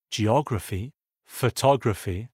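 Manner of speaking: "geography, photography", each stressed third syllable from the end; antepenultimate-syllable stress. Shown as a demonstration. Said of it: "sont accentués sur l'avant-avant-dernière syllabe".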